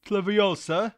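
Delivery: loud, high British rendering; mock British accent. Streamer challenged to say a spell, high mock British accent. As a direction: say it as an exaggerated Brit, questioning. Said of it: "see if you can say it Leviosa".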